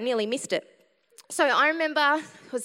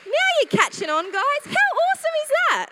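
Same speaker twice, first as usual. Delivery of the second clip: much higher, loud, fast excitement; ecstatic; 13 semitones higher, 8 dB louder than usual. Real, not acted. Preacher celebrating her healing to the crowd, ecstatic. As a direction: burst out in joy.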